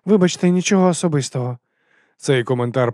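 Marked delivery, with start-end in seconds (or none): 0.1-1.6 snide, raised and louder apology; higher-pitched, pointed jibe. A narrator's reading. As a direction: say it as a barbed false apology. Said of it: "А ще їх хідна фраза".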